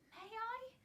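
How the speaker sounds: high, trembling little question; tremulous. A narrator's reading. Mary asks her guardian tremulously, high and quavering. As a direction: ask timidly, voice quivering up high. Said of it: "she said tremulously".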